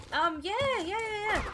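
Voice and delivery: high-pitched, hasty-sounding dentist's reassurance; dentiste évasif. Retold or imitated. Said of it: "And he's like".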